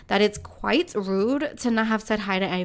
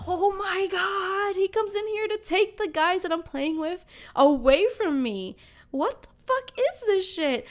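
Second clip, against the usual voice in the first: much higher, drawn-out Oh my God, then indignant; can't believe he's stealing her players; outraged disbelief; 8 semitones higher than usual. Retold or imitated. Disbelief as guests poached.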